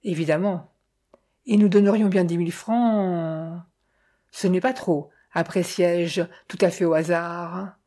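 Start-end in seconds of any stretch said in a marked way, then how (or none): none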